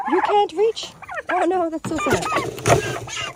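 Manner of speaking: mock pity.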